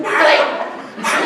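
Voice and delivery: high, urgent mock call; hammed-up, strained call; imitated dog calling. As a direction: call a runaway dog in the night. Calling runaway dog at night.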